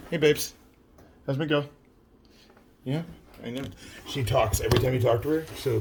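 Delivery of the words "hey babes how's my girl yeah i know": talking to the cat. Real, not acted.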